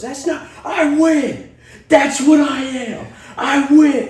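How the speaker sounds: shrill, slow, loud gloating devil voice with a laugh. Retold or imitated. Retelling the movie, devil taunts the son with a shrill gloating laugh. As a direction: cackle it like the devil who just won.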